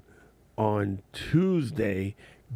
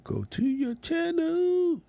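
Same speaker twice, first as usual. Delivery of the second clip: very high, slow cartoon squeak; high chipmunk voice, slow; squeaky high voice; slower than usual. Shown as a demonstration. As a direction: stretch it out in a tiny squeaky voice. Repeats in high voice.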